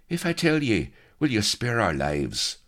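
high, anxious bargaining; shifty demand. A narrator's reading. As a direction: bargain nervously for your life. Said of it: "perspiring shiftily and demanding".

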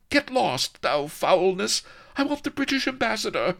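shrieky high damsel voice; proud, indignant lady. A narrator's reading. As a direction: order the villain off, pitched very high. Lady Vanity in character.

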